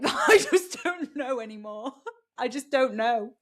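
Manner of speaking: words bubbling through real laughter; amused, overwhelmed laugh-talk. Real, not acted. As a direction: giggle helplessly while saying it. Laughs at her own confusion.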